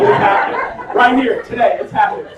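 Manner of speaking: loud, excited declaration; reliving the thrill out loud; thrilled anticipation. Retold or imitated. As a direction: build up loud and high, exulting. Retells thrill as sister closes in.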